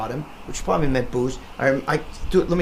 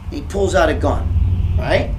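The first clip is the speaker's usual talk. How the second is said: ordinary delivery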